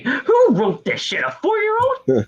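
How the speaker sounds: incredulous, mocking the writers; exasperated disbelief at a dumb script; scornful disbelief. Real, not acted. Mocking bad writing.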